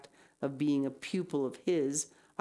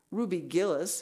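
ordinary delivery

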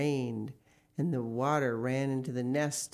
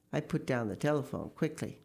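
ordinary delivery

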